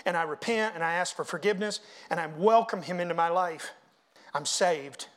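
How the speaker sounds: usual speaking voice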